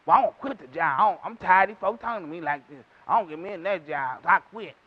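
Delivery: low, muttered imitation of a fed-up worker; complaining quitter. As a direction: grumble it low like someone quitting in a huff. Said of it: "we gonna quit that job".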